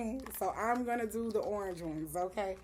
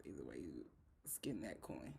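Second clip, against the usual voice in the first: whispered aside; 16 dB quieter than usual. Real, not acted. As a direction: breathe the words out as a private joke. Aside under her breath.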